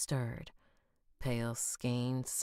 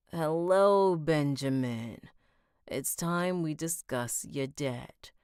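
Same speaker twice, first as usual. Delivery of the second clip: menacing boss voice; 2 semitones higher, 6 dB louder than usual. Acted. Villain Mister Biggs greets Ben.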